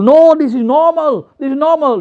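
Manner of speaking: high-pitched, exasperated pleading; mock insistent protest. Newcomer trying to explain to people, acted insistent protest.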